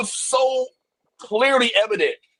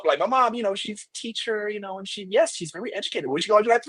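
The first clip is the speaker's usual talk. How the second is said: usual speaking voice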